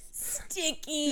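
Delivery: soft, laughing sticky; laughing imitation. Retelling roommate's line while laughing, giggly mimic. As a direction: imitate her through giggles. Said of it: "she was like this".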